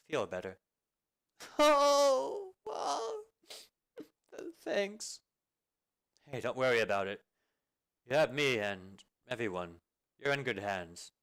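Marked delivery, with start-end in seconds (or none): 1.6-5.2 high sobbing wail easing into a tearful thanks; teary wail fading to a wet thank-you; crying it out. Acted. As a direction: cry hard, then calm to a teary thanks. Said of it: "let it all out".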